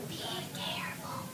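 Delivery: hushed, unvoiced whisper. Teacher repeats what children said, whispered. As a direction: echo it in a tiny whisper. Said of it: "we all used our whisper voices".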